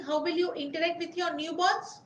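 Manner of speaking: normal talking voice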